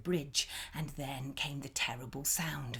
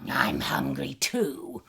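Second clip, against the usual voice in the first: low, slow, louder troll; troll replying to the goat, slow and gruff; 4 semitones lower, 6 dB louder, slower than usual. A narrator's reading. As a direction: grumble like a hungry troll. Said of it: "replied the troll".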